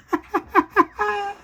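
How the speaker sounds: high, unhinged mad laugh; villain's cackle. Acted. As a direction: cackle like a killer in his cell. Villain in prison cell.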